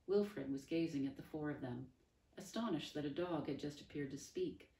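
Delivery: usual speaking voice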